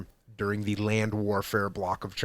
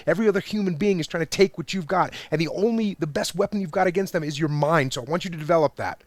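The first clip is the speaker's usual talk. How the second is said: ordinary talk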